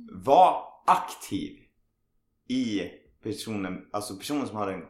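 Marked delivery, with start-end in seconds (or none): 0.1-1.6 slow, louder, each word weighed; emphatic advice. Shown as a demonstration. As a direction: drive the advice home. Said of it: "tips som anhörig".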